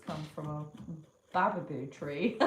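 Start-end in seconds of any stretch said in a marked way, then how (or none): none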